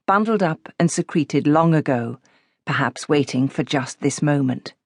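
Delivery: ordinary delivery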